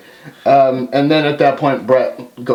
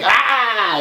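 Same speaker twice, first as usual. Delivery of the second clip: mock scream; 8 semitones higher than usual. Stone Cold screaming.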